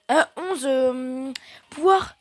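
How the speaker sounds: ordinary talk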